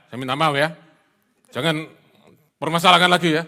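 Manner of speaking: loud, forceful mock threat; threatening apology. Offender demanding the matter be dropped, threatening. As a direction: bark the apology like a warning.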